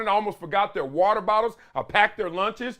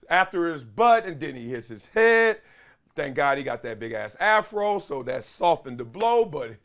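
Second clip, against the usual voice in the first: ordinary talk, nothing marked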